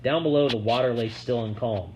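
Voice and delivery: usual speaking voice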